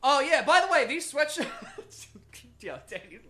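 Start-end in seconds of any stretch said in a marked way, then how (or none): 0.0-1.5 excited. Real, not acted. Excited laughing plug.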